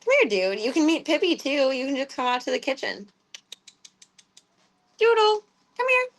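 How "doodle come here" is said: high pet call. Real, not acted. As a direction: coax the cat in a very high sing-song. Calling the cat.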